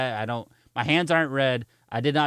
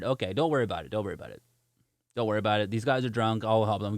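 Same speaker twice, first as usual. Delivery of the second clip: imitated dismissive cop; 6 semitones lower, 4 dB quieter, much faster than usual. Cop waves him off.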